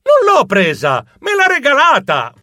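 loud, high indignant reply; protesting shout. Acted. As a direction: shout back in your defence. Protests accusation. (protesta gridata e indignata)

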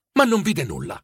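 ordinary delivery

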